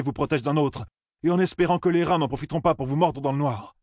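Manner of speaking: high, urgent appeal to the villagers; Perrine, impassioned. Acted.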